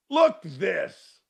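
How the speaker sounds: ordinary talk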